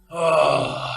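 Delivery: loud annoyed groan; group grumble. Acted. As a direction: groan like a fed-up crowd. Passengers grumble.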